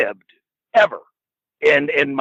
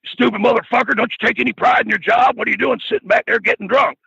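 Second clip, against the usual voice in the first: higher-pitched mock scolding; mock berating promo; 8 semitones higher than usual. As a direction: rip into the rookie, half-imitating. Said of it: "he's cutting promos on me".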